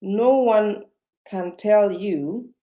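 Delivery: ordinary delivery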